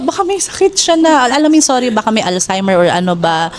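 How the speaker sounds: ordinary talk, nothing marked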